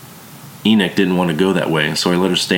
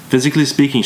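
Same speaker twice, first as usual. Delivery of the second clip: lightly voiced German woman, raised pitch; a hint of a strong German accent; 8 semitones higher, faster than usual. A narrator's reading. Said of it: "She had a strong German accent".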